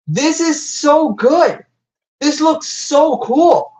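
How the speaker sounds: drawn out, dreamy praise. Real, not acted. Admiring the toy slowly.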